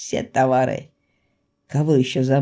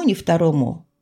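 ordinary delivery, nothing marked

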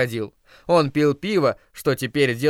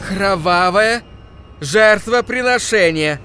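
higher, louder ominous declaration; loud solemn demand; 6 semitones higher, 5 dB louder, slower than usual. Acted. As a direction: ring out the demand for blood. Zealot demands sacrifice.

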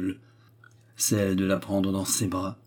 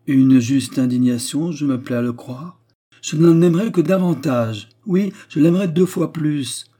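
louder, higher, emphatic declaration; passionate defence; 5 semitones higher, 6 dB louder than usual. A narrator's reading. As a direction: defend her with rising fervour. (plaidoyer passionné)

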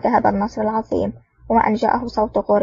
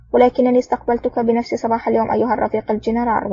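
ordinary delivery

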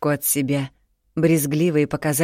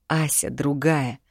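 ordinary delivery

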